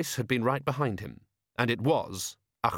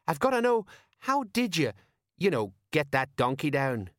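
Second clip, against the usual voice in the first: baffled sergeant; 6 semitones higher than usual. Acted. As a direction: press hesitantly for an answer.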